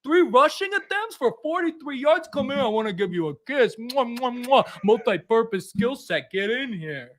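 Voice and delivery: a hint of an adoring uncle showering praise; doting family welcome. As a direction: fuss over the new arrival like a proud relative. Welcome-to-the-family bit, doting host.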